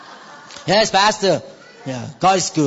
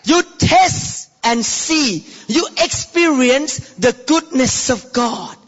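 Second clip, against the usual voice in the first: normal talking voice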